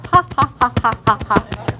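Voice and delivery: deliberate put-on "ha ha ha" laugh; demo laugh. Shown as a demonstration. Leader shows the class a tapping laugh, hammed-up demo laugh. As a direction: show them how to laugh while tapping. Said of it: "you can even laugh".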